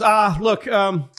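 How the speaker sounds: stammer, just go to the website; frazzled staffer. Retold or imitated. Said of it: "He goes".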